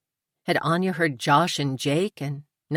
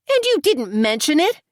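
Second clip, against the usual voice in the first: much higher, louder indignant question; 12 semitones higher, 6 dB louder than usual. A narrator's reading. Tori to Amy about the diary, indignant and loud. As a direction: Tori rounds on her in disbelief.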